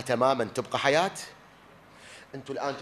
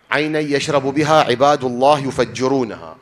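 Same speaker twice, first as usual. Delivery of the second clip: louder, chanted verse recital; Quranic recitation; 10 dB louder than usual. Shown as a demonstration. Preacher recites the verse about the spring to listeners, louder chant.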